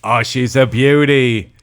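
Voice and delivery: loud, slow, drawn-out Australian accent. Shown as a demonstration. Showing off the requested accent for a friend, broad Australian. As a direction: savour it as a hammy Aussie. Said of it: "to go into an Australian accent".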